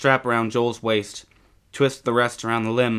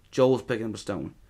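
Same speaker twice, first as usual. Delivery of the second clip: ordinary delivery, nothing marked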